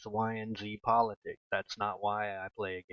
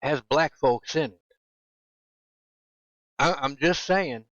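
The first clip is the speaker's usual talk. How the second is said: emphatic punchline; 4 semitones higher, 8 dB louder than usual. Real, not acted. Emphatic sarcastic point.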